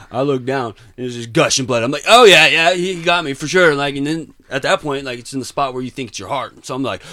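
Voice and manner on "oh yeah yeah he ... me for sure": high, loud admission; retold sudden realization. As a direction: concede it loudly, reenacting the moment. Acts out realizing he was stabbed.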